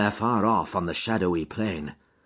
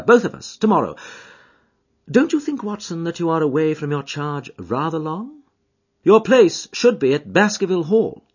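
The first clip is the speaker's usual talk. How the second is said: Holmes; 7 semitones higher, 3 dB louder than usual. A narrator's reading. Voices Holmes planning in dialogue.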